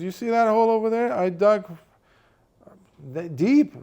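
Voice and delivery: loud, high, fast protest; indignant shouting. Acted. Poor friend pointing out his holes to the rich friend, indignant and loud. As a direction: defend yourself, exasperated.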